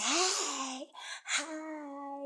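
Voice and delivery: high, loud sing-song hey hi; mock chirpy greeting. Mocking the woman at court with a high, loud hello. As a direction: ham up her over-eager greeting. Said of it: "this bitch is like".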